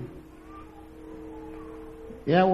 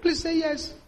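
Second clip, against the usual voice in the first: high pleading, half laughing; begging groom; 8 semitones higher, slower than usual. Retold or imitated. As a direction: plead with her, voice lifted. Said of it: "the poor man was begging".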